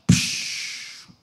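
voiceless psh of a punctured tire; hiss of escaping air. Retold or imitated. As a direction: breathe out a sharp psh like a tire letting go.

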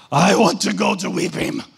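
loud, slurred drunk imitation; drunk man. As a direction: play the drunk villager asking to come along. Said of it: "no, no, excuse me".

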